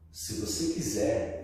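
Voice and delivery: slow, measured preacher's line; stop, then say it slowly, word by word; drawn-out solemn offer; pastor's deliberate pause. Retold or imitated. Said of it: "o pastor parou assim".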